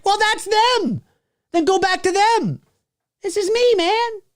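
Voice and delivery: very high, strained comeback; mock exasperation. Host answering protesting teens, high mock exasperation.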